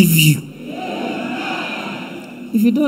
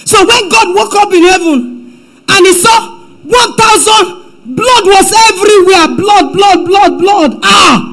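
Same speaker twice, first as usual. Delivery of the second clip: preaching; 8 semitones higher than usual. Acted. Preacher dramatizes God waking to blood, shouting. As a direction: cry it out, blood everywhere.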